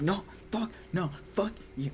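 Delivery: a mild mock outburst at her daughter; mock livid refusal. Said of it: "her mom was livid".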